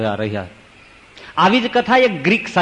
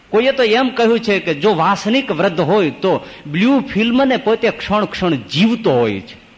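usual speaking voice

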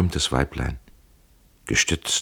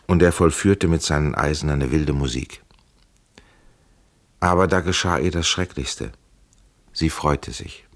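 ordinary delivery

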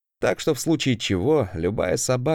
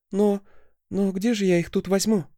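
high, flustered student voice; shy student; 9 semitones higher than usual. Acted. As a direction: stammer like a timid pupil. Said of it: "стеснительную ученицу".

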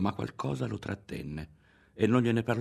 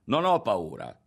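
raised, firm defiance; louder, resolute retort; defiant; 3 semitones higher, 5 dB louder than usual. A narrator's reading. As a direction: stand up to the threat. Boy defies a threat.

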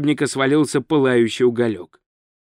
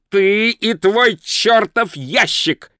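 slow, higher, accusing cry; angry yell; 5 semitones higher, slower than usual. Acted. Thief blames the wizard and his box, slow angry yell. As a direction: stretch out the angry outburst. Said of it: "заорал он".

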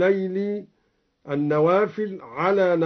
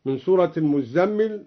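normal talking voice

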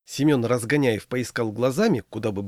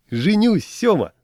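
much higher, louder, gleeful announcement; giggly; 10 semitones higher, 6 dB louder, slower than usual. A narrator's reading. Groom telling his friend he is marrying, giggly. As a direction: announce it in a high, tickled voice. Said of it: "хихикнул Трущоткин".

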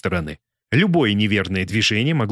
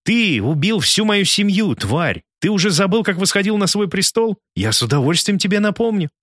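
hateful accusation; 9 semitones higher than usual. A narrator's reading. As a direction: throw the accusation in his face. Kim accuses the president's murders.

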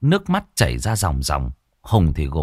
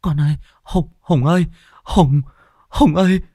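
put-on high, shaking old woman's stammer; stammering in terror; 11 semitones higher than usual. Mother stammering at her son about the ghost, terrified. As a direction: stutter out the warning, terrified. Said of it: "bà Bắc lắp bắp".

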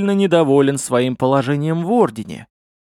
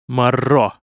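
ordinary delivery

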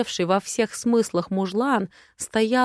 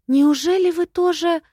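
put-on high, louder gasping girlish voice; shocked little lady; 10 semitones higher, 6 dB louder than usual. Lady Harsh gasps at Sincera's secret love, high and loud. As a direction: squeal the scandal behind tiny hands. Леди Харш прикрыла губы.

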